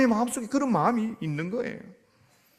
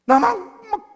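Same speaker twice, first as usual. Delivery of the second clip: put-on high, whiny voice of a sulking child; sulky child; 10 semitones higher, 6 dB louder than usual. Pastor voicing a child upset over a test, high whine. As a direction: whine like a kid who flunked a test. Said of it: "이러고 있어요".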